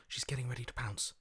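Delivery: quick urgent whisper; fast whisper. Acted. Voice warns companion of the pounce, whispered fast. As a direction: hiss the warning before she strikes. Predator about to strike.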